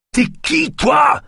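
very high, loud challenge; enraged shout, pitched right up; furious roar. A narrator's reading. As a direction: roar it at a stranger. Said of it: "rugit Naya".

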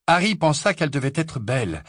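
usual speaking voice

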